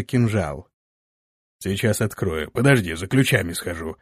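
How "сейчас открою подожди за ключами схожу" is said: грубый голос. A narrator's reading. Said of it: "Прозвучал тот же грубый голос".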